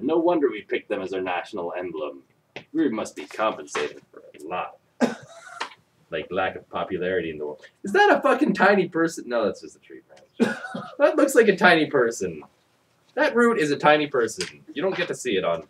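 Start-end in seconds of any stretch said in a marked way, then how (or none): none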